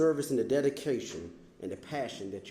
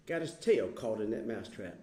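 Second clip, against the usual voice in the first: ordinary talk, nothing marked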